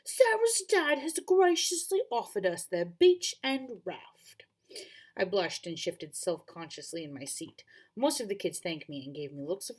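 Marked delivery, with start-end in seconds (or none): 0.0-4.4 slow, breathy British teacher voice; British accent. Acted. Teacher telling the class about the beach, in a British accent. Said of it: "I forgot to do the British accent".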